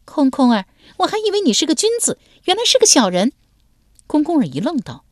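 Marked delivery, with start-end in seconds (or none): 0.0-3.3 put-on high, fast scornful young woman; mocking cold laugh in her voice; cold sneer. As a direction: sneer it at him, louder. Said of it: "冷笑道".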